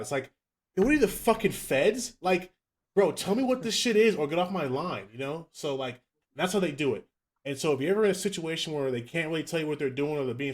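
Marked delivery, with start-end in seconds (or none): 0.8-5.1 higher, louder outraged comeback; mock outraged retort. A podcast host mocks telling a vague recruiter to get off the phone, higher and louder in mock outrage. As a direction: snap back like you've been played.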